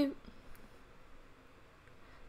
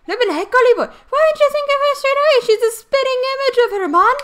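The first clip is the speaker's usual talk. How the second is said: excited fairy sidekick voice; 12 semitones higher, 6 dB louder than usual. Acted. Game character Drippy voiced.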